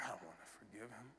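quick, breathless whisper of protest; whispered refusal. Retold or imitated. Retelling his answer to God, hushed whisper. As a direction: whisper it like a private argument with God.

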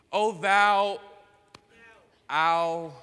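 loud, high-pitched mock-holy intoning; exaggerated churchy address to God; mock pious prayer. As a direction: boom it out like an over-formal prayer. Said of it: "you're talking to him weird".

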